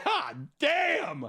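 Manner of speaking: high, sharp God damn; shocked exclamation. Real, not acted. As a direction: blurt out in shock.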